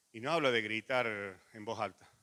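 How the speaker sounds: normal talking voice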